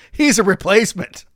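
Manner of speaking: hammed-up indignant wife; imitating wife. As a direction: play the confused wife tugging his arm. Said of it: "turns me around, like".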